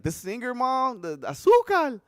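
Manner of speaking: put-on a boy piecing together who Celia is; kid catching on. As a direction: build up as the boy remembers. Child figuring it out, retold.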